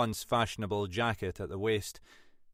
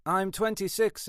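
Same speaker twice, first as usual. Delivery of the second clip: TWENTY-SIX stated firmly in a raised pitch; assertive answer; 10 semitones higher than usual. Acted. Young man defends his age.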